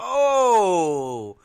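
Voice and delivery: long, loud, high "oh" sliding down; drawn-out ringside exclamation; shocked "oh!" shout. Real, not acted. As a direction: cry out at a heavy blow. Reacting to a heavy blow.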